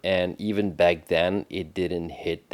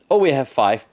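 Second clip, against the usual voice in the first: mock dismissive voice; 5 semitones higher, 8 dB louder than usual. Mocking the show's writers.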